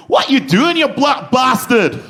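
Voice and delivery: loud, high shouting in a bad Scottish accent. Scottish stranger insulting the comedian, shouted in a bad Scottish accent. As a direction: bark it out in a rough Scottish accent. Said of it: "a shit Scottish accent".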